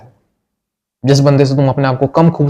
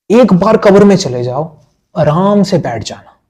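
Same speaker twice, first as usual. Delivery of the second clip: higher and louder, preaching to the viewer; raised pep-talk voice; fervent exhortation; 6 semitones higher, 5 dB louder than usual. Real, not acted. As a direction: drive it home with force. Fervent motivational push.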